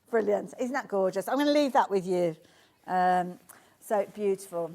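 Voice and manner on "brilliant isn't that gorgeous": normal talking voice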